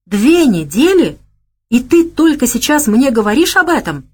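furious she kept it secret; angry disbelief. A narrator's reading. Lyosha angered by hiding. (гневное возмущение)

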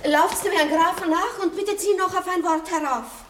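laut und hoch nach den Lakaien rufen; hastig, fast atemlos hinterherrufen; drängender Befehl. Acted. (die Marschallin ruft den Lakaien laut und drängend nach)

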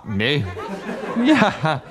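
ordinary delivery

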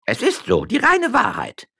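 very high eager confirmation; chorus of servants. Acted. Servants confirming the wife's story, eager. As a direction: affirm it together.

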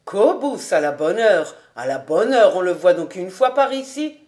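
loud cheers for Kobus; group exclaiming. A narrator's reading. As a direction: shout a welcome across the alley. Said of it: "s'écrièrent".

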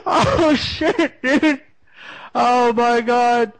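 high, giddy disbelief; excited laugh. Real, not acted. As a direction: squeal through the laughter. Cracking up after prank call.

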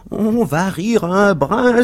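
high, slow, drawn-out cry; exclaiming cabmen. A narrator's reading. Cabmen gather to watch a fight, exclaiming. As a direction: call it out high like a jeering onlooker. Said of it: "s'écrièrent cinq ou six cochers de voitures".

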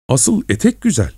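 high-pitched, wounded cry; plaintive protest. A narrator's reading. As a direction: plead her case in a high voice. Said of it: "Ethel acıklı bir sesle". (acıklı, tiz bir sesle)

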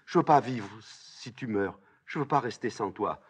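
high, pleading lament; anguished plea. Acted. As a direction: beg not to be left behind. Ismène supplie de mourir avec elle. (supplique angoissée, voix haute)

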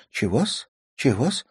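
lightly squeaked, rising at the end; a touch of a squeak; squeaky voice. A narrator's reading. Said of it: "ещё таким пискливым голосом".

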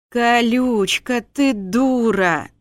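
slow, loud, long-suffering groan; exasperated groan. A narrator's reading. Husband picking up his drunk wife, slow exasperated groan. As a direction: groan it out, stretching each word. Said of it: "простонал змей".

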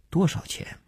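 soft, half-asleep question; sleepy murmur with little voice; drowsy. Acted. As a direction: ask as if just woken, barely voiced. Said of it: "睡意朦胧地问".

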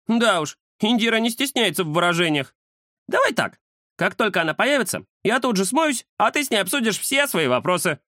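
high-pitched cat character voice; cat Кузя, scheming. Acted. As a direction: lay out the plan in a high cat voice. (высокий голос бойкого кота)

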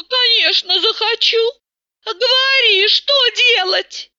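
a hint of keen helpfulness; Tata, eager. A narrator's reading.

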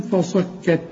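ordinary talk, nothing marked

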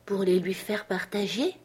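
a hint of horrified disbelief; voice failing in horror. A narrator's reading. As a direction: repeat "les lui faire partager" as the voice gives out. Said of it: "la voix me manqua".

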